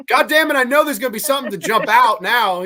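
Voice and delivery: high, loud, exasperated retelling; mock scared protest. Kid protesting to dad before a scare, loud mock dread. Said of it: "wanna see me get scared".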